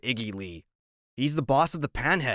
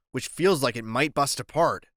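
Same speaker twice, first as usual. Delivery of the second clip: usual speaking voice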